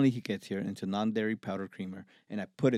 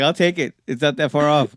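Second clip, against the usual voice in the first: high and loud, amused and emphatic; eager acceptance; 6 semitones higher, 11 dB louder than usual. Retold or imitated. Engineer happily taking the rapper's nickname, amused eagerness. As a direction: jump at the offer, tickled by it.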